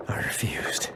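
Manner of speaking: faint, breathless whisper; weak whisper. Acted. Wounded elf telling Geralt his story, whispering. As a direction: barely get the words out.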